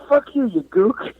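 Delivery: high, slowed mock-angry comeback; joking insult back. Trading joke insults with his friend, high mock anger. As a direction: fire it back half laughing. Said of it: "we're laughing and fighting".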